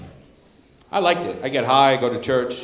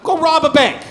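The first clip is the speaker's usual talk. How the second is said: higher, pushy mock urging to rob a bank; mock pep talk; 6 semitones higher than usual. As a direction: egg them on like a hammed-up hype man. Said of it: "I was like".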